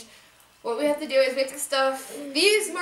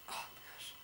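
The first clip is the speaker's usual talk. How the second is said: whispered; 20 dB quieter than usual. Real, not acted. Exasperated aside about copycats.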